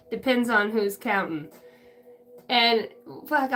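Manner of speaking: normal talking voice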